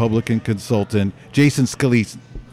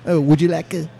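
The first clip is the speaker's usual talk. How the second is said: higher, softer fake Italian waiter; Italian waiter accent; 5 semitones higher, 5 dB quieter than usual. Waiter offering water to a diner, thick fake Italian accent. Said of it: "like Italian, right?".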